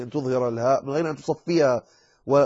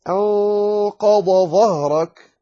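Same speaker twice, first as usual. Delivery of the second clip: أنقض ظهرك slow, high and louder, dad and dha kept apart; clear dad and dha; 6 semitones higher, 6 dB louder, much slower than usual. Shown as a demonstration. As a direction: stretch أنقض ظهرك so both letters ring out. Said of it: "عليك أن تظهرها".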